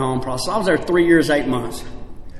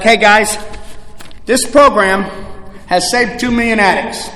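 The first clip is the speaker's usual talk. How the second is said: raised, loud pitch to newcomers; upbeat program pitch; 5 semitones higher, 5 dB louder than usual. Retold or imitated. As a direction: call out the good news to the group. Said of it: "and they're like".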